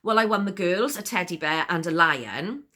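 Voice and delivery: ordinary delivery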